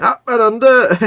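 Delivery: high, loud "Up and under" with "under" falling; joking call of a rugby phrase; hammed-up catchphrase. Playful catchphrase, then laughs.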